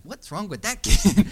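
high, loud mock bystander with a laugh; puzzled onlookers. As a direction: play the baffled adults staring, laughing. Onlookers' reaction voiced.

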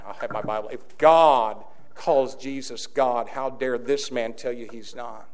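ordinary talk, nothing marked

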